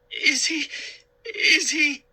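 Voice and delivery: high, scared stammer, near tears. A narrator's reading. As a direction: stammer through fear. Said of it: "Sari stammered".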